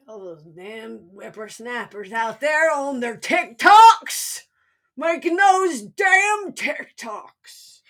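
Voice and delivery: put-on slow, loud cranky old-timer shouting; old prospector. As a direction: rant like a crotchety old coot yelling at kids. Daughter parodies grumpy old rant.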